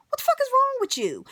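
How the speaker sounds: high, rapid-fire indignant outburst; real outrage. Real, not acted. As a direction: let the exasperation fly up high. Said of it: "that grinded my gears so bad".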